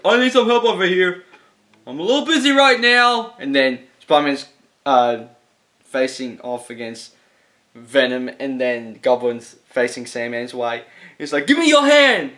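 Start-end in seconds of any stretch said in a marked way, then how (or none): 0.0-3.4 higher, a bit louder strained plea; mock hero call. Spider-Man asks for help mid-fight, lightly voiced higher. 11.5-12.3 much higher, louder shouted plea; urgent call. Retold or imitated. Goblin shouts to Spider-Man to grab his hand, loud and high.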